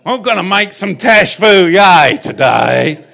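loud, high Arkansas preacher impression; Arkansas accent. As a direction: holler it slowly in a mock Arkansas accent. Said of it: "That's how they do it in Arkansas".

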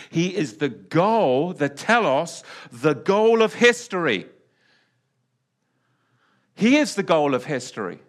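rising to a loud preaching peak; swelling pulpit emphasis. Real, not acted. Preacher building on key point.

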